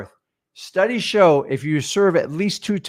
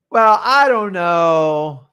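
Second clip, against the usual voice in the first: drawn-out, louder and higher hedging; unsure seller; 4 semitones higher, 5 dB louder, much slower than usual. Acted. Homeowner dodging the buyer's price question, unsure. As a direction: stretch it out as a noncommittal seller. Plays hesitant seller in demo.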